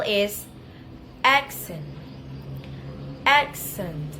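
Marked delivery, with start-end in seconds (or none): 1.2-4.1 "accent" with AC hit strongly; strong first syllable. Shown as a demonstration. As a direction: punch the AC of "accent", let the second syllable fall away. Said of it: "say the first syllable is strongly".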